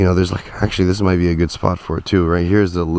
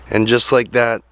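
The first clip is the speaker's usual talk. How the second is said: usual speaking voice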